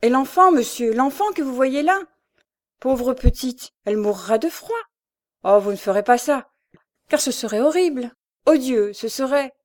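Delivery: loud, high-voiced mother's appeal; desperate pleading. A narrator's reading. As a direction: beg him for the child's sake. Mother pleads for her child. (mère suppliante, indignée, voix haute)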